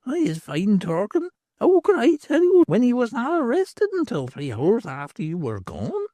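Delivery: put-on a knowing old beldam; old hag's voice. Hag answers.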